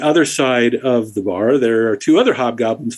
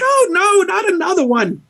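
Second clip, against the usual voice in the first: high, exasperated wail; despairing barkeep. Acted. Barmaid as a glass shatters in her bar, high exasperated wail. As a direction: wail as something else shatters. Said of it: "and she's like".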